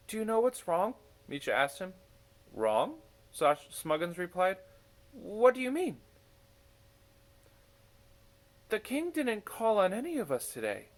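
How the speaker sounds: put-on high young voices, concerned questions; childlike character voices. A narrator's reading. Misha asks Smuggins what is wrong, high and puzzled. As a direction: ask gently, puzzled.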